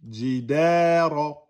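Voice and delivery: Jidara with long "da" and heavy "ra"; two beats, emphatic ra. Shown as a demonstration. Teacher modelling length and emphasis, slow Jidara. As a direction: stretch the middle of Jidara, end on a dark "ra". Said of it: "Da, deux temps".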